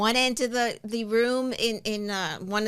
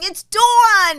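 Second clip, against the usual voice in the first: loud, high New York DAWN; New York accent; 9 semitones higher, 9 dB louder than usual. Acted. Giving up correcting them, loud New York accent. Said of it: "I end up saying".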